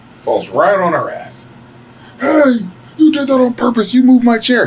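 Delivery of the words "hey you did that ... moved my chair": high, loud wail of blame; hammed-up high-pitched tantrum; mock outraged accusation. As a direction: imitate an indignant woman crying foul. Acted accusation by the fallen woman.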